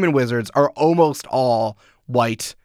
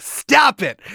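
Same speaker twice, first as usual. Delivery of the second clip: mock scolding cry; 10 semitones higher than usual. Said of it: "the human wizards were like".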